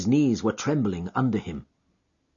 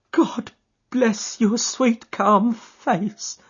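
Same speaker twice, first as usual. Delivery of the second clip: hysterical sob; 13 semitones higher, 4 dB louder, much slower than usual. Acted. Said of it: "she cried with a hysterical sob".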